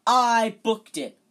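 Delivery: loud, high, slow furious protest; captain spitting with rage at his rival. Acted. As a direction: spit each word out in rage. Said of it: "positively spitting with rage".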